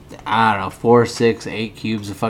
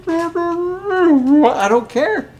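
high, very slow sing-song I don't care; mocking don't care; much slower than usual. Real, not acted. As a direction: stretch it out high to mock him. Mocking dismissal of Steven.

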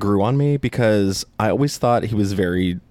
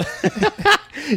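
high laugh; 13 semitones higher than usual. Real, not acted. Laugh at teeth.

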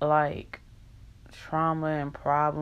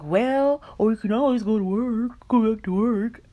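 high, loud mock-brush-off voice; hammed-up dismissive boss; mocking the manager; 4 semitones higher, 5 dB louder than usual. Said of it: "It was like".

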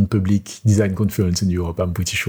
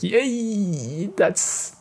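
excited cheer; 12 semitones higher, 4 dB quieter, much slower than usual. Retold or imitated. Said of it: "You can imagine how I was like".

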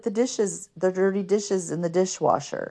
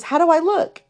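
high, eager question; anxious girl; 11 semitones higher, 4 dB louder than usual. Retold or imitated. Girl asking a stranger how she looks, high and eager. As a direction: ask it like a nervous new girl. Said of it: "And she goes".

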